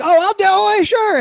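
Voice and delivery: high, chirpy people-pleasing yes; over-eager agreement. Acted. Comic pushover agreeing to a lousy job, over-eager. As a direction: jump up high, hammed-up eagerness.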